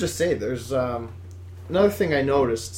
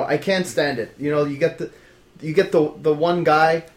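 ordinary talk, nothing marked

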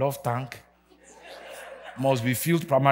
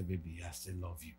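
tender; 9 semitones lower, 17 dB quieter than usual. Retold or imitated. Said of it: "I would look at my wife and say".

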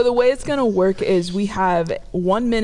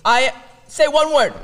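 loud over headphones; shouting through noise-cancelling headphones; yelling; 6 semitones higher, 5 dB louder than usual. Real, not acted. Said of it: "You are yelling".

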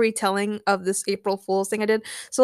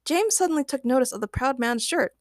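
usual speaking voice

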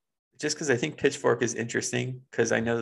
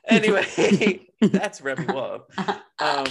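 laughing; 5 semitones higher, 5 dB louder, slower than usual. Real, not acted. Laughing while moving on.

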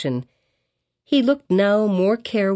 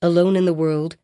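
ordinary delivery, nothing marked

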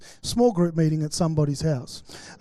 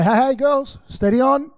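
higher, louder, slow pastoral warning; loud slow hey steady on; mock warning; 6 semitones higher, 4 dB louder, slower than usual. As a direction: rein the girls in, half joking. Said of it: "And I'd say".

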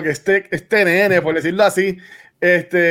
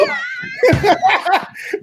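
burst of laughter; 13 semitones higher than usual. Real, not acted. Laughing at own joke.

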